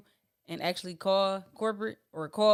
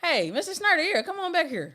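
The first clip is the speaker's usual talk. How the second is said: mock welcoming banker; 7 semitones higher, 3 dB louder than usual. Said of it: "you be like".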